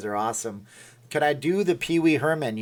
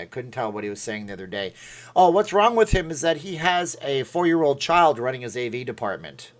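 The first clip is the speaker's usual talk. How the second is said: ordinary delivery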